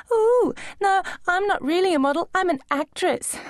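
high, sneering imitation of a wannabe actress; mock her in a prissy high voice; mocking girl voice. A narrator's reading. Gossiping model mimics the new girl, high and sneering.

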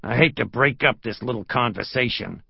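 a hint of a menacing lieutenant; lightly voiced menace; menacing intrusion. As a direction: break in with veiled threat. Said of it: "A low-pitched, menacing voice intruded".

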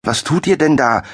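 high, agitated outcry; upset cry. A narrator's reading. Young Arturo confronts the soldiers, crying out upset. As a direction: protest at the soldiers. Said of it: "schrie er aufgebracht".

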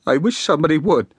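a wife's firm, cold reply; firmly. A narrator's reading. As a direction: answer him flat out. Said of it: "said she firmly".

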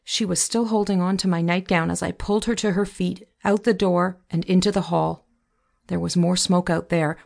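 plain reading voice

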